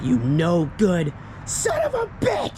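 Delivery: high, drawn-out imitated outburst; mock freak-out. Vlogger playing a woman who finds out she was tricked, mock freak-out. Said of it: "And she freaks out".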